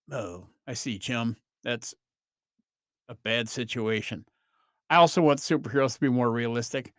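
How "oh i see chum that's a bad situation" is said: deadpan Batman speaking to his chum, lower; Adam West Batman. Retold or imitated. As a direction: lower and softer, mock square-jawed hero calm. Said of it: "talk more like the Adam West Batman".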